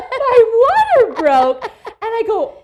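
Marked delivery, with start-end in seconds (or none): 0.1-1.6 high, loud, laughing through the words; laughing disbelief. Real, not acted. Laughing as she tells the sisters her water broke.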